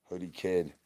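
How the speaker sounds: ordinary delivery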